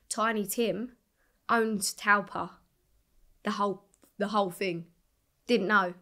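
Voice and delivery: ordinary talk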